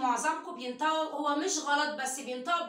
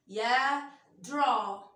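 ordinary talk, nothing marked

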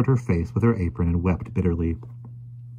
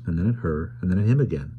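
ordinary delivery, nothing marked

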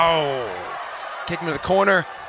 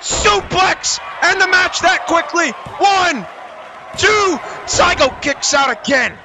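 wrestling commentator; 8 semitones higher than usual. Acted. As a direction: call the near fall like ringside. Ringside call of a near fall.

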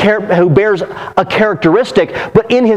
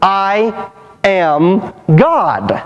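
slow, weighty, each word set apart; very clearly; much slower than usual. Retold or imitated. Preacher voicing Jesus' claim to the congregation, slow and weighty. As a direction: land every word slowly and heavily. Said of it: "Jesus is saying very clearly".

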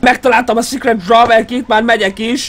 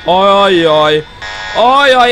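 slow uh-oh, rising to a yelp; drawn-out worried groan; much slower than usual. Real, not acted. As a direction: fret as danger comes. Worry as enemy approaches.

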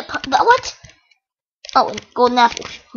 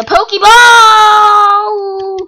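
slow, stretched, much louder cry; hammed-up shout; 6 semitones higher, 8 dB louder, much slower than usual. Gamer explaining the squid to viewers, stretched mock shout. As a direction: drag it out like a game announcer.

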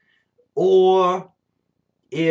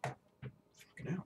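under-breath curse; 3 semitones higher, 18 dB quieter, much slower than usual. Real, not acted. Startled by noise outside.

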